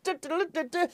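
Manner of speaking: high sung news jingle; news jingle hum. Pretend. Mock Sky Sports News jingle, sung high. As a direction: hum the breaking news sting. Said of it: "Scrolling yellow ticker tape manner".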